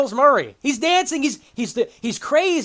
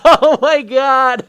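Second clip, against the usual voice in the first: loud, high laughing disbelief; helpless, drawn-out exclamation, laughing; incredulous laughter; 8 semitones higher, 10 dB louder, much slower than usual. Real, not acted. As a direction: cry it out through real laughter. Incredulous laughter at reviewer's remark.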